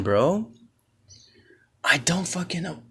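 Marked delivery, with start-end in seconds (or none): 1.8-2.8 soft unvoiced whisper. Real, not acted. To viewers, wondering which insult stings, whispered. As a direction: breathe it out under your breath.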